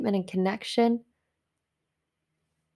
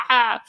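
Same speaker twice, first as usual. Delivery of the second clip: short exasperated "ah" groan, louder; frustrated groan; 3 semitones higher, 7 dB louder than usual. Retold or imitated. Turned away from Walmart, she groans in frustration. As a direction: let out a frustrated ah. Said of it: "so frustrated".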